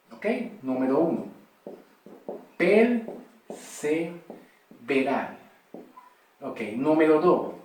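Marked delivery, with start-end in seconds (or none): 2.6-5.4 perseverar drawn out very slowly, each syllable stretched; slow emphasis. Shown as a demonstration. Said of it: "Vamos a enfatizar".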